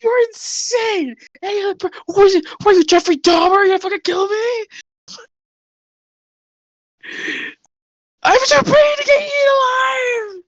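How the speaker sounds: high-pitched hysterical prisoner; panicked captive. Acted. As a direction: squeal in terror at your captors. Oasis panicking at captors.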